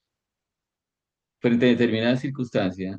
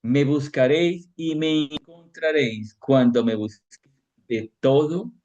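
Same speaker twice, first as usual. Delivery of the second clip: slow, raised, solemn reading of the verse; solemn scripture reading; 4 semitones higher than usual. Acted. Preacher to congregation, a slow solemn scripture reading. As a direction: proclaim each phrase slowly, like scripture. Reads Jeremiah aloud.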